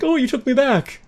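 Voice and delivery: high squeaky delight; surprised squeal. Pretend. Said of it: "It's like".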